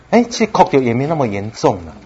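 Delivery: usual speaking voice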